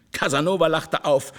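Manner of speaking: ordinary delivery, nothing marked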